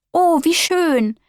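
high, slow, drawn-out delighted gasp; little spider thrilled by the booklet. Acted. Little spider delighted at the doctor booklet, high and drawn out. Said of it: "freut sich die kleine Spinne".

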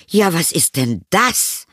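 high, slow startled exclamation; exclaiming. A narrator's reading. Mother spots cloth in cupboard, exclaiming. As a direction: exclaim it like a mother making a find. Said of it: "mit dem Ausruf".

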